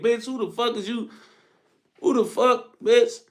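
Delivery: hammed-up street girl snapping back; imitating aggressive woman. Mocking aggressive woman's challenge.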